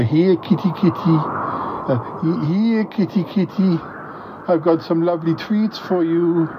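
high sing-out to a kitty; coaxing cat call. Acted. Man calls kitty with lovely treats, coaxing.

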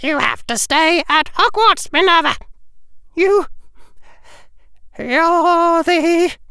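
high, faltering little voice; tiny half-goblin voice. Acted. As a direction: stammer it out anxiously. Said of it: "trouble speaking".

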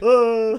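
high, loud mock falling "oh"; fake falling sound. Co-host acting out a prank on the gang, fake falling sound. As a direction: cry out as if toppling, badly faked. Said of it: "like, a fake falling sound".

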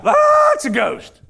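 high, loud, drawn-out scream of terror; freaked-out scream. Acted. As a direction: freak out and shriek it in mock panic. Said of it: "They freak out".

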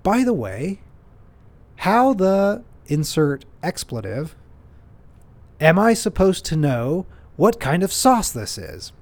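a touch of a rude customer snapping at the worker; retold rude question. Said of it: "he says".

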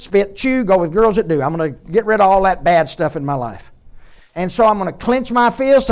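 a preacher lampooning a loud resolution, then the shout; mock self-reformer. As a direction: play the man gritting his teeth to be good. Mocking a self-reformer's vow.

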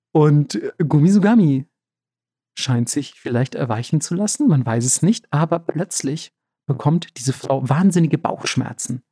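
ordinary talk

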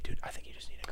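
whispered soothing; gentle whisper. Retold or imitated. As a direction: whisper it kindly. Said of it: "If they were, like, just like".

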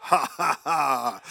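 a hint of a maniacal laugh; mock-crazy ha ha ha. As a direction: own laugh, lightly maniacal. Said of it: "it almost sounds maniacal".

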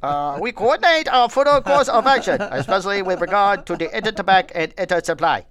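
high, loud put-on German accent; reading the statement in a hammed-up German accent; mock German accent. Host reads a German statement aloud, hammed-up German accent. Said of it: "That's exactly how he sounds".

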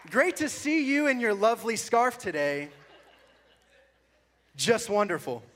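hammed-up reading of an awkward old post; reading his old post. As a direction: read it out like a cheesy compliment, chuckling. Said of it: "If you read this in the right tone".

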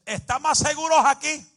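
loud, high urgent cry; retold alarmed shout. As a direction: yell it like a panicked shopkeeper. Shop owner shouts warning in quake.

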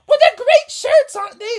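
high, squeaky cheerful mom voice; giddy team mom. Acted. Missus Frogerson cheering the boys on, high and giddy.

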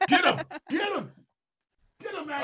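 high, loud hype cheer; excited urging. Retold or imitated. Friend hyping up a fighter, shrill cheering. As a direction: egg him on like a ringside fan.

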